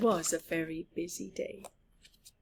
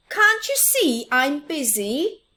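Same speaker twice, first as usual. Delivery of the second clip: high, loud busy call; 7 semitones higher, 8 dB louder than usual. Acted. Little Mouse calling back to Big Mouse, loud call. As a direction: call it back without stopping. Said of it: "he called".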